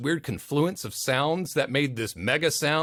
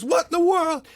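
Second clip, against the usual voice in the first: high, loud shocked cry; startled sister yelling in the car; sister's shocked shout; 6 dB louder than usual. Retold or imitated. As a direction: shriek it in alarm. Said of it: "My sister goes".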